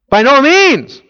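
high, loud protest; emphatic refusal. Retold or imitated. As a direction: push back hard on the Lord. Peter's refusal voiced.

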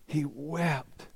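soft, breathy, hushed grief; hushed sorrow. Acted. Preacher telling Peter's weeping, hushed and soft. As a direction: let the words fall to a hush.

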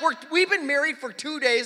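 high, loud retold protest; acted-out freaked-out complaint; panicked yelling. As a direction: plead loudly in mock panic. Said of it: "I am yelling, I'm freaking out".

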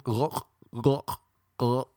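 throaty gulping bird noises; imitated regurgitating. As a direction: choke up little gulps like a feeding bird. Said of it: "Just regurgitating".

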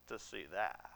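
whisper. Acted. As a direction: whisper it as a private scheme. Scheming aside to himself.

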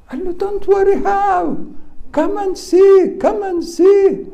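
very high, loud panicked squeal; acting out a servant in panic; frightened protest. Disciple urges Elisha to look at the armies, shrill panic. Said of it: "he was still frightened".

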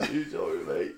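lightly voiced Cockney gangster; gangster impression. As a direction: try the line again in a gruff Cockney. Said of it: "Why did I sound like Alfie Sullivan".

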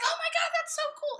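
very high, loud, rushed imitation; delighted squeal. Sister reacting to the coming-out news, delighted squeal. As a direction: gush at top pitch as the thrilled sister.

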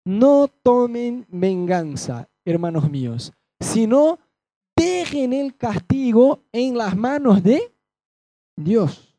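slow, solemn reading of the verse; scripture reading. Acted. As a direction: read it weighty and slow, like from the pulpit. Reads scripture aloud. (lectura bíblica solemne y lenta)

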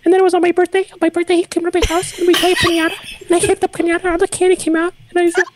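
put-on high kid voice telling a story; little boy voice. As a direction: chatter like a small child. Plays a little boy named Tim.

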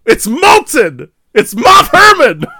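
loud, high, slow shouted line. Pretend. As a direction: yell it like a movie hero. Shouting a movie-style line.

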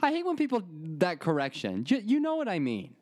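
high, indignant mock rant. He rants at the pharmacist's correction, high and indignant. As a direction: sputter it high and offended. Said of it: "and I'm like".

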